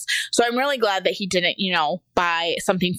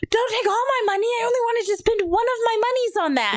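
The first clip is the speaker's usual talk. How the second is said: very high mock wail; hammed-up child protest, pitched way up; imitated crying child; 13 semitones higher than usual. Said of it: "he's, like, crying and screaming".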